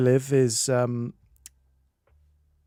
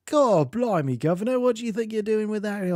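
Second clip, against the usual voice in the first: high mock Scouse accent, hammed up; exaggerated comic Scouse accent; 8 semitones higher than usual. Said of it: "the Scouts that's like".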